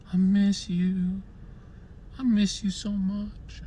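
high, slow, far-off pining voice; emotional pining. Retold or imitated. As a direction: draw out the longing like a lovesick kid. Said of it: "I start getting emotional".

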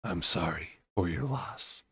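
soft, slow murmur. A narrator's reading. Tamlin offering condolences to Fyra in a soft murmur. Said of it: "and murmured".

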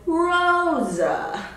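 Rosa stretched long, ending in uh; long O, then uh, in Rosa; drawn-out pronunciation demo. Shown as a demonstration. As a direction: sing out ROSA slowly and high. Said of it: "the E making an uh sound".

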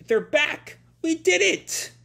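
high, loud happy cheer. Retold or imitated. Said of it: "And Happy cheers".

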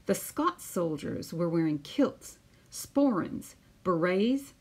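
put-on lively storytelling voice for children; picture book read-aloud. Teacher reading a picture book to her class, lively storytelling. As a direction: read it out like story time on the mat.

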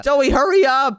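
loud urgent call across the field. Acted. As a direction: shout to hurry her along. Calling out to fighting friend.

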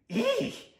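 very high, loud comic squeal; horrified eek. Acted. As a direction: squeal in mock horror. Tooth horrified.

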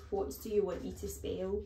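ordinary delivery

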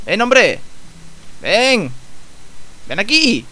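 loud call with pitch rising up. Real, not acted. Player calling a game enemy to come here, calling out. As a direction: shout to summon someone over. Calls a game creature over.

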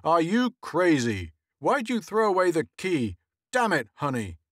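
loud exasperated outburst; blowing up. A narrator's reading. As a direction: explode at his wife. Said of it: "Henry blew up".

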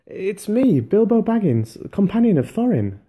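high, loud urgent cry; cried out. Acted. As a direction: shout to be heard at once. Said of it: "he cried".

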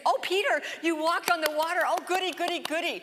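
high, mocking fawning praise; sing-song mock congratulation. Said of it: "He didn't commend Peter and say".

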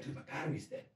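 hushed whispered reply. Retold or imitated. Friend whispering back to him at the party. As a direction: murmur it back under your breath. Wade answers quietly.

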